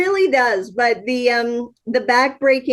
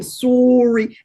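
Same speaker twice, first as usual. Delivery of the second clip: Canadian "sorry". Retold or imitated. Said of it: "like the Canadian".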